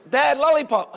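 put-on high, insistent little boy; imitation of a kid demanding a treat; nagging young son. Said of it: "It keeps going on and on".

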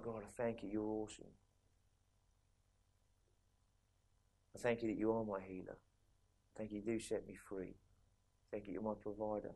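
Dankgebet, sehr leise und innig gesprochen; hushed prayer. Shown as a demonstration. Soft prayer of thanks.

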